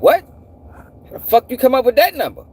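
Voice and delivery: high-pitched disbelief; incredulous reaction. Retold or imitated. Man retelling his reaction to an absurd crime figure, incredulous. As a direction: demand an explanation in disbelief.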